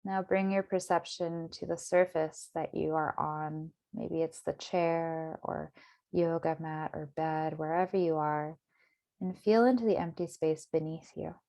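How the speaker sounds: calm, measured meditation voice with long pauses; guided meditation. Acted. Meditation teacher settling listeners into their bodies, guided meditation. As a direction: guide them gently inward, unhurried.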